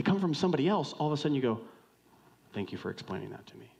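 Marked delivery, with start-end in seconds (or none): 2.5-3.7 soft, quick, humbled thanks; sincere gratitude. Acted. Friend thanks the one who explained, softly grateful. Grateful reply to kinder messenger.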